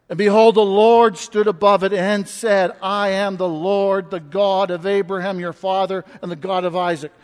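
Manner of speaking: booming pulpit reading of scripture; scripture proclaimed. Acted. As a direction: preach it out with full force. Rereading scripture with force.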